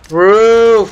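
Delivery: high, loud shout of a warning; callout shout. Real, not acted. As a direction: yell the callout to the team. Calling out enemy position.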